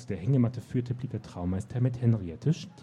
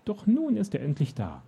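high, overwrought relief; emotional climax of long waiting; overjoyed; 10 semitones higher than usual. A narrator's reading. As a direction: start high with joy and fall away. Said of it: "Seine Stimme überschlug sich fast".

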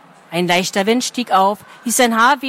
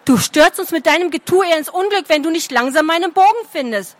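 put-on scolding girl; 7 semitones higher than usual. A narrator's reading. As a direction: tell him off sharply.

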